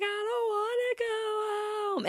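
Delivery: high, drawn-out mock wail; crying child. Little boy carried out by dad, mock bawling. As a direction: imitate a kid wailing in protest, slowly. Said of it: "the kids start crying".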